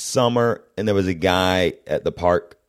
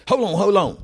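southern guy excited; 11 semitones higher than usual. Retold or imitated. Southern guy voiced in story.

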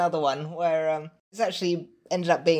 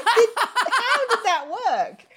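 high, slow, laughing amazement; squeaky laughing astonishment; laughing disbelief; 4 dB louder, much slower than usual. Retold or imitated. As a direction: marvel through laughter that it worked. Parents amazed the trick worked.

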